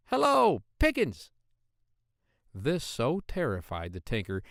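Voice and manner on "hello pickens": high, loud little call; a small boy yelling from inside a pudding; tiny shout. A narrator's reading. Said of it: "called out at the top of his little voice".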